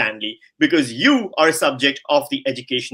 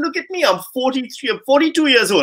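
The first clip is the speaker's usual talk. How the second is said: much higher, comic exasperation; self-mocking exclamation; 9 semitones higher than usual. Real, not acted. Host joking about his own age to the debater, high and comic. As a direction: throw yourself in as the sorry example.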